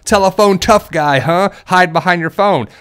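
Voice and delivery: high, loud taunt at a telephone tough guy; a loud jeer thrown at a caller who acts tough; mocking taunt. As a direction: jeer at him for hiding behind the phone. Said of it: "That's what it used to be growing up".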